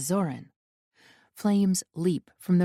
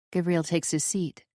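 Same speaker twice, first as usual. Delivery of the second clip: ordinary delivery, nothing marked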